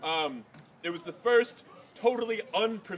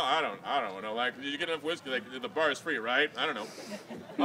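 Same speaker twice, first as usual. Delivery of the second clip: a lightly voiced, lower tipsy guest mumbling; tipsy wedding guest; 7 semitones lower, 5 dB quieter than usual. Imitating a confused guest at the back of a wedding, low mumble. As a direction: play a guest who's had the free whiskey, lightly.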